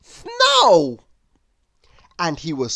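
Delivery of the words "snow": very high, loud delighted cry; excited shout. A narrator's reading. Mister Silly thrilled to see snow, shouting. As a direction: burst out with joy.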